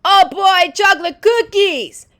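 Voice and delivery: high, loud excited shout. A narrator's reading. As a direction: yell it out in delight. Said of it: "Lewis shouted".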